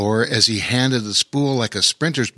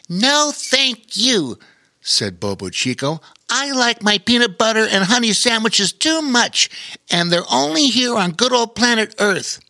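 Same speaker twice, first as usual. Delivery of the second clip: otter voice; 11 semitones higher than usual. A narrator's reading. Otter declining to be an astronaut, high voice. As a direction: voice the otter, happy to stay home.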